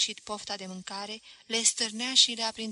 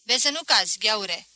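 careful warning; 8 dB louder than usual. Acted. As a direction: call out a caring caution. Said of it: "Voap, grijuliu".

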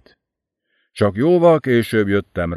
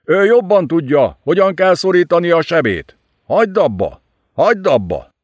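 a louder, higher yell; 7 semitones higher, 3 dB louder than usual. Acted. Laskili yells at Enid to stop. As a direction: bellow at her to stop. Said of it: "üvöltötte Laskili".

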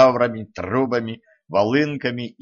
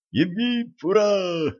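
much higher cheering yell; 9 semitones higher, slower than usual. A narrator's reading. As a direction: whoop it like a rowdy crowd. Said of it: "завопили черти".

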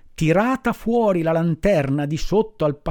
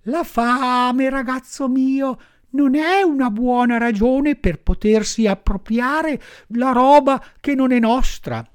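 high, kindly firefly voice, much higher; gently moralising firefly; 10 semitones higher than usual. Acted. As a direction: lecture the boy kindly from above. Firefly lectures Pinocchio.

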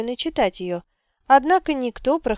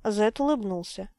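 usual speaking voice

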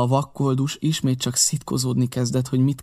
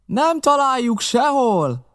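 high, slow call back; drawn-out raised voice; shouted answer; 12 semitones higher, 4 dB louder, slower than usual. A narrator's reading. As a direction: holler the failure down to the boss. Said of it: "válaszolt az ember".

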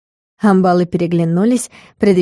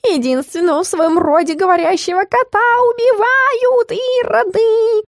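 climbing, very high cat yowl; Стикс wailing; 12 semitones higher than usual. A narrator's reading. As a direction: melodramatic wail stretching to a held top note. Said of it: "заорал Стикс".

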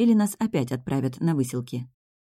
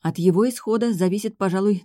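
usual speaking voice

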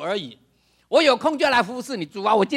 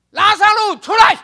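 loud, high commanding shout; commanding call; 12 semitones higher, 12 dB louder than usual. Retold or imitated. Jesus calls Lazarus out of the tomb, a loud high command. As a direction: call him out of the grave with full authority.

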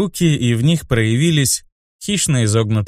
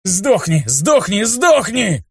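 rising higher with each repeat; hysterical yelling; 8 semitones higher, slower than usual. Acted. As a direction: shriek it in hysterical rage. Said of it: "истерично вопил он".

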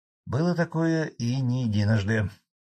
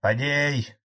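restrained shout, pitched higher; calling out in protest; 5 semitones higher than usual. A narrator's reading. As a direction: call the name out in alarm. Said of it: "буквально закричал со своего места Фролов".

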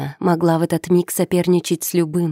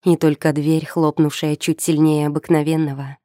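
ordinary delivery, nothing marked

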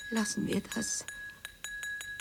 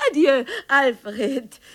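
bitter laugh; 3 semitones higher, much slower than usual. Acted.

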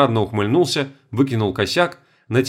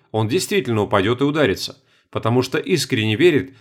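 plain reading voice

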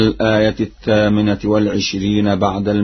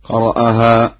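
ordinary talk

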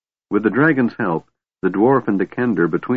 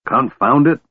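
higher, exasperated outburst; mild roar; 6 semitones higher, 4 dB louder than usual. A narrator's reading. Dwarf cuts off the chattering kender, mildly roaring. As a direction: snap with understated anger. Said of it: "Flint roared".

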